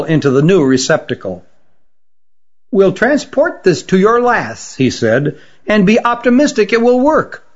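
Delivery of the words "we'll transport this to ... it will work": hopeful healer. Acted. Lydor offers hope.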